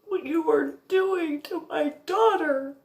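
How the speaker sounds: furious through tears, holding nothing back; crying rant. Retold or imitated. Tearful rant at mother.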